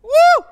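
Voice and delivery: high, loud "woo"; sudden excited whoop; celebratory whoop. Real, not acted. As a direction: let out a jubilant cheer. Preacher cheers after his point.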